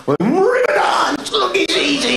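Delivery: shouted high and slow. Acted. Comic yelling about river dance to the audience. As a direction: belt it out, way up high.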